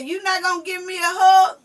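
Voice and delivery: loud, high hurt question; a grandma calling out a sulky kid, loud; mock hurt plea. Grandma asking her sulking granddaughter for a hug, loud and high.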